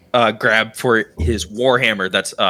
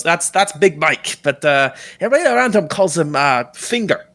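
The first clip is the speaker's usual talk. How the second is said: higher-pitched captain introducing his crew; friendly captain; 5 semitones higher than usual. Acted. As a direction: play the captain explaining a crewmate's nickname. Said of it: "he goes".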